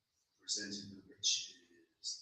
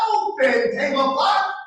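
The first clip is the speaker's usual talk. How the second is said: command; 12 semitones higher, 5 dB louder than usual. Acted. Command that fails.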